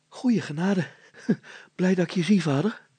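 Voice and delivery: breathless, quick and high; panting. A narrator's reading. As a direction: gasp out the relief. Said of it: "hijgend zei hij".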